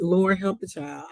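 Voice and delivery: ordinary talk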